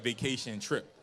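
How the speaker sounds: usual speaking voice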